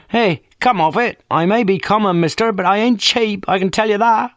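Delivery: put-on cheeky working-class girl on the phone; brash young woman. A narrator's reading. Friday Banks answers the inspector, cheeky and common.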